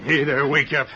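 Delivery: loud wake-up order; waking call. Acted. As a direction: rouse him with a firm shout. Rousing a sleeping man.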